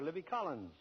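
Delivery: usual speaking voice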